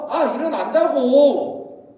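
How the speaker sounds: hammed-up defiant kid protest; exasperated back-talk. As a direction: whine back at mom, dragging the words. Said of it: "등짝을 때리는데도".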